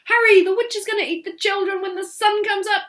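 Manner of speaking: high, loud and fast squeak; urgent mouse. Acted. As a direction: rush him, panicking. Mouse urges the eagle.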